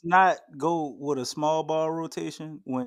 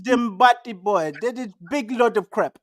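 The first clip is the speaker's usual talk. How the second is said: put-on Jamaican accent; playful Jamaican impression. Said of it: "say it in your Jamaican voice".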